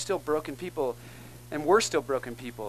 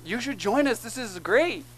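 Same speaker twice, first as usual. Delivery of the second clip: higher-pitched, lightly imitated teenage enthusiasm; eager teens; 7 semitones higher than usual. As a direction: voice keen teens inviting a stranger in, pitched up. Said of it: "And they're like".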